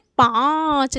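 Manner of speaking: पाँच with very long nasal आँ, separate च; nasalised vowel demo. Shown as a demonstration. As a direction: stretch the vowel of पाँच through mouth and nose. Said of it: "कैसे उच्चारित कर रहे हैं?".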